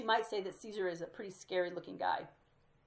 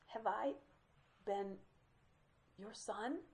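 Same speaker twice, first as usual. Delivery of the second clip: wavering voice; 6 dB quieter, slower than usual. Retold or imitated. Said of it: "makes his voice waver and crumble".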